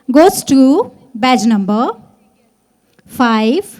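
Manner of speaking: loud, drawn-out winner announcement; booming prize announcer. Acted. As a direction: build up and boom the badge number. Announcing winner badge number. (इनाम की ज़ोरदार घोषणा)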